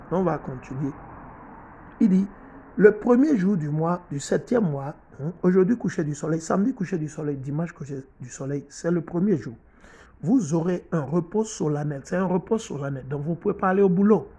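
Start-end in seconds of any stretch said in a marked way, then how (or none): none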